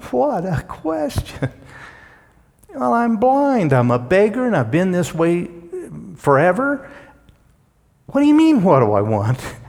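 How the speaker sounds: high-pitched, exasperated disbelief; hammed-up indignant protest; mock incredulity. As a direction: act the beggar baffled by an obvious question. Acts out incredulous blind beggar.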